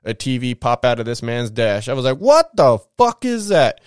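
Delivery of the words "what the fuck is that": high, loud, drawn-out astonishment; amazed. Retold or imitated. Kid seeing a pop-up car TV, amazed. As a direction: reenact being blown away, slow and loud.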